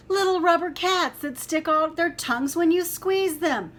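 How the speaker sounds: high, loud eager cubs; excited shout. Acted. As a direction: shout it like children spotting a toy. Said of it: "shouted the cubs".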